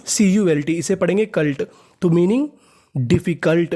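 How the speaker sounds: usual speaking voice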